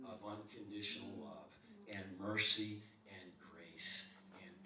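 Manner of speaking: low, soft, slow sermon delivery; hushed, measured, earnest sermon; solemn preaching. Real, not acted. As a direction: preach it quietly with weighty pauses. Solemn preaching beside lively storytelling.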